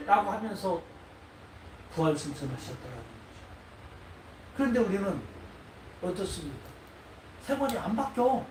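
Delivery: ordinary delivery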